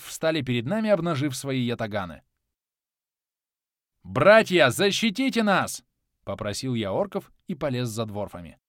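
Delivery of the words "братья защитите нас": loud, slow rallying cry; rallying call. A narrator's reading. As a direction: call the brothers to arms. Hero calls orcs to defend.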